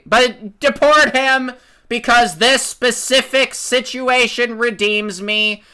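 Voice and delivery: high, loud, slow hysterical shrieking; mock outrage. Mocking hypocrites shrieking to deport Khalil, high hysterical yell. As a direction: shriek like a pearl-clutching hypocrite.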